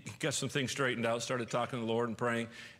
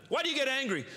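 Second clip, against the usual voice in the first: ordinary talk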